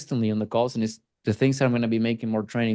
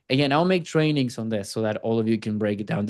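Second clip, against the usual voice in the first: ordinary delivery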